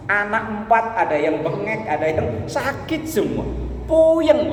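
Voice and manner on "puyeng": high, loud cry of a man at wit's end; exasperated groan. Retold or imitated. Preacher voicing a broke father, high and loud. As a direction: wail it like your head is spinning.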